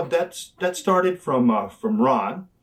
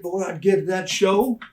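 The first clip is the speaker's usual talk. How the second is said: ordinary talk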